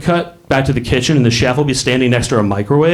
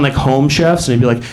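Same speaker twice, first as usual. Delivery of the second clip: usual speaking voice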